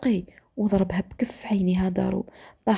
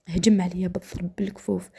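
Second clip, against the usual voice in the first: ordinary delivery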